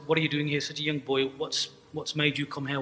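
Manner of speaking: normal talking voice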